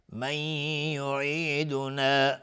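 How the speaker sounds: slow chant, n of "من" melted into a hummed y; merging with ghunna. Shown as a demonstration. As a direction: hum long from "من" into "یعیدنا". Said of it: "نون ساکن بهش یا ادغام با غنه". (ادغام با غنه «من» در «یعیدنا»)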